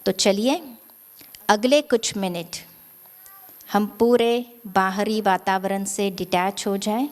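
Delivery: calm, hushed, spacious delivery; guided meditation. Real, not acted. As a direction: guide them gently into stillness, phrase by phrase.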